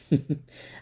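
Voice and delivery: small chuckle in the face of doom; little chuckle. A narrator's reading. Captain chuckling at his doom. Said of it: "giving a little chuckle".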